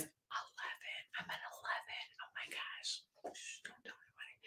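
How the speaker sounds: playful whisper, like sharing a secret. Pretend. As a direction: whisper it so nobody else hears, teasing. Shoe size confessed as a secret.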